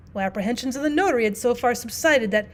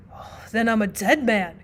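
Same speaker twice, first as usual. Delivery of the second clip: ordinary delivery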